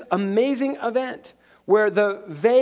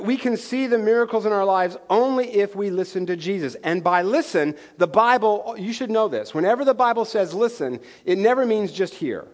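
usual speaking voice

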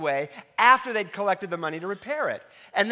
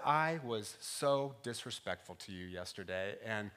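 contrite apology; 7 semitones lower, 8 dB quieter than usual. Retold or imitated. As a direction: humbly ask forgiveness. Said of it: "and I said".

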